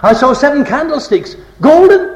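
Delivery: "golden" leaps high and loud at the end; stress on golden. Shown as a demonstration. Preacher showing Greek emphatic word order, stress on "golden". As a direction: build through the phrase and punch "golden". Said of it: "The emphatic word's always at the end".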